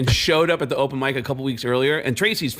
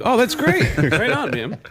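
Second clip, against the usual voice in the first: fake enthusiasm; 8 semitones higher, 3 dB louder, slower than usual. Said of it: "I'm like".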